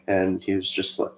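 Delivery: normal talking voice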